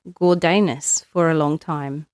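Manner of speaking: ordinary delivery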